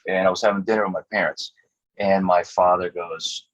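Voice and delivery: ordinary talk, nothing marked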